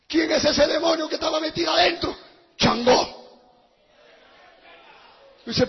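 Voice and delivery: normal talking voice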